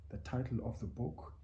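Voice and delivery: ordinary delivery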